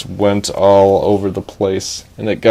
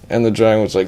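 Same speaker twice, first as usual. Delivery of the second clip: ordinary delivery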